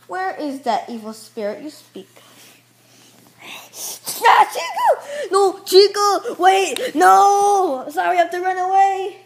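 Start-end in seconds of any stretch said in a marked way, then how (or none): 4.2-9.2 high, drawn-out panicked shouts; mock terrified yelling. Abandoning Chico while running away, high panicked yelling. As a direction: wail the cries high, stretched out.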